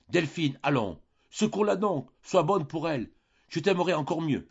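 high-pitched, pressing appeal to help; urgent plea. A narrator's reading. Father begging Delphine to help her fainting sister, urgent high plea. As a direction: plead anxiously, pitched well up.

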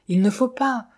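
understated fright; frightened stammer. A narrator's reading. Narrator answering Sophie's talk of dying, mildly frightened. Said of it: "balbutiai-je effrayé".

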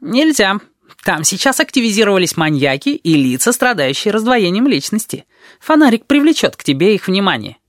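high, busy character voice full of warning; fussing Tabaki. A narrator's reading. Tabaki warns about maniacs in the corridors, high fussy warning.

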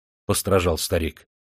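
plain reading voice

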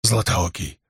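out of breath, rasping; gasping hoarse. A narrator's reading. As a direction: gasp the words out, dying. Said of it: "задыхаясь, прохрипел раненый".

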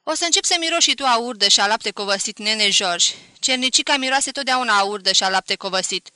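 loud, fast mocking taunt; spiteful jab. A narrator's reading. Cousin Vastea taunting George about his bride, spiteful and loud. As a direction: sneer with open spite. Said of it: "nu-și stăpâni ciuda".

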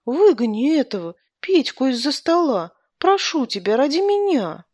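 high, pleading husband's appeal; lightly hammed-up pitiful plea; plaintive. A narrator's reading. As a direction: beg your wife plaintively, pitch climbing towards the end. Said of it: "жалобно сказал он жене".

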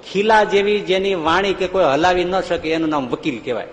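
ordinary talk, nothing marked

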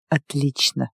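low, soft male reply; agreeing werewolf. A narrator's reading. Werewolf agreeing as he undresses his lover, soft and low. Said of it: "согласился оборотень".

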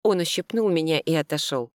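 plain reading voice

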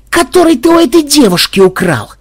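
high and loud accusing shout; furious yell. Acted. Programmer yells at the thief about the girl, furious shout. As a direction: bark the accusation at him. Xenobyte continues yelling.

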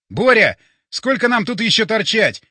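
loud, high impatient shout. A narrator's reading. Narrator to Borya while shooting creatures, shouting. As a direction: shout the question over the fight. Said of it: "крикнул я".

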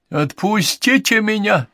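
very high, drawn-out panicky squeal; girlish squeal. A narrator's reading. Podmore trapped in the circle, girlish squeal. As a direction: squeal it like a frightened girl. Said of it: "визжал Подмор, как девчонка".

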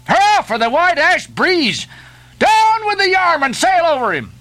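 very high, jubilant hurrah; exhilarating cry. A narrator's reading. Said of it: "with an exhilarating cry of".

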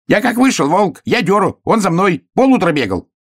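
high, breakneck excited account; squeaky worked-up hare; agitated hare. A narrator's reading. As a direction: gabble it out high and indignant. Hare protests to bear.

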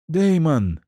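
high, sharp frightened cry. Acted. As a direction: cry his name out in fright. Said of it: "Резкий вскрик Коралл".

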